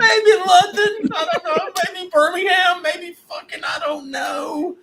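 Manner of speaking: high, laughing through the words; laughing guesses. Real, not acted. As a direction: giggle out the guesses.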